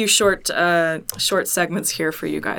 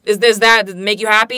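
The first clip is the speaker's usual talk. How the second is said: usual speaking voice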